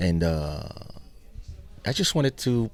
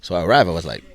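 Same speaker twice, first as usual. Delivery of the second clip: ordinary talk, nothing marked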